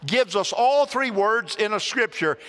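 ordinary talk, nothing marked

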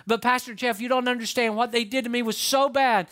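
acted wounded complaint; aggrieved protest. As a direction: plead your grievance to the pastor. Voicing a protesting church member.

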